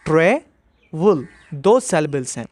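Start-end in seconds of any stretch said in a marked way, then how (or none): 0.1-1.3 slow syllable demo. Shown as a demonstration. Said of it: "ये एक ऐसा verb है जिसमें दो सिलेबल्स हैं".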